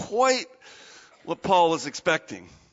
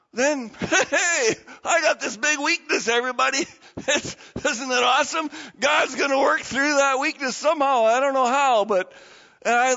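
higher, louder, laughing cheer about his weakness; cheerful acceptance; 6 semitones higher, 3 dB louder than usual. Retold or imitated. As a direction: announce your weakness to everybody with glee. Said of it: "And Paul goes".

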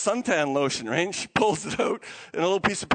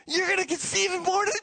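high, excited angelic news; excited announcement; 9 semitones higher than usual. Acted. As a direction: announce wonderful news, barely contained. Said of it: "Isn't that exciting?".

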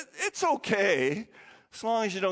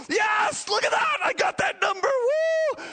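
high, loud victory cheer; 4 dB louder than usual. Retold or imitated. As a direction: whoop as your number comes up. Cheering as ball drops.

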